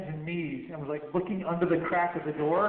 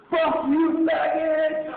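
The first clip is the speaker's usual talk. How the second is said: high, loud mocking shout; yelled insult; 11 semitones higher, 6 dB louder, much slower than usual. Cop in a passing armored car yelling at them, loud jeering shout. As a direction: bellow it from a passing truck.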